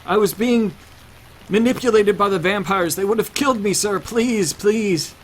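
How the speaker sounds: high, loud frantic begging; desperate pleading. Acted. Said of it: "And Henrik pleads".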